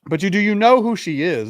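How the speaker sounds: retelling his own pointed question to the woman, incredulous. Retold or imitated. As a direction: push back in mock disbelief. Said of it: "I'm like".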